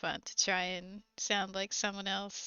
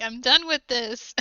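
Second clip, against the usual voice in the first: retold exasperation; 2 semitones higher, 11 dB louder than usual. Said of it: "I'm like".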